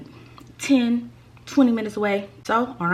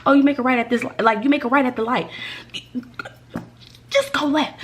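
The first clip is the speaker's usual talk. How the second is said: frustrated retelling; 4 semitones higher than usual. Retold or imitated. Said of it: "I literally said".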